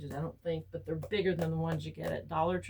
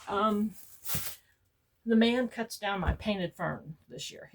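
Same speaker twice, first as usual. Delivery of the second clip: normal talking voice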